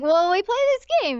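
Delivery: high, loud chirpy heroine; cheerful girl voice. Retold or imitated. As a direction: brightly explain the game. Voicing the heroine.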